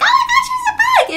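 put-on very high, loud squeal of a frightened six-year-old, laughing; mock kid panic through laughter; panicked child. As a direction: shriek it like a scared little kid. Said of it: "she was like".

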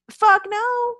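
high and loud, flat refusal; loud retold refusal; emphatic refusal. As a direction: shut the idea down hard. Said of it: "I went".

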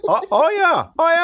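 high, loud mimicked catchphrase; exaggerated copy of a friend's cheer; imitating Tony. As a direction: leap up in pitch with a goofy odd accent. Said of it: "just all mimicking that".

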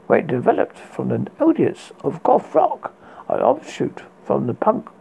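plain reading voice

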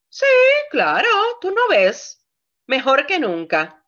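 sweet question, then biting sarcastic reply; sarcastic wife. Retold or imitated. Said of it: "Totalmente sarcásticas".

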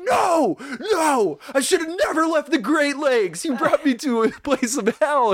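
high-pitched, hammed-up wailing tirade; imitated over-the-top outburst. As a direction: go to one hundred, mimicking a movie meltdown. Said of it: "he goes to one hundred".

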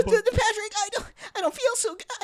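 very high dying cartoon; fading Patrick voice. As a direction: fade out like Patrick.

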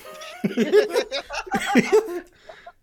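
loud high burst of laughter; big laugh. Real, not acted. Buddy cracking up over free donuts, loud high laughter. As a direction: crack up loudly.

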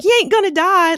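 high, loud West Virginia holler; mock yell in a West Virginia twang; Meg O'Death. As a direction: imitate a West Virginia derby captain yelling. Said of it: "she's got this West Virginia accent".

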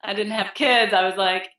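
ordinary delivery

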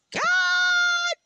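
drawn-out rapture at the roast dinner; excited shout. Real, not acted. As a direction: groan with joy over the food. Craving the dinner.

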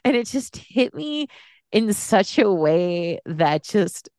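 choked up, voice lifting and wavering with real emotion; really fighting back tears; near tears. Real, not acted. Film fan recalling how the ending hit her, choked up. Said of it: "I'm gonna cry again".

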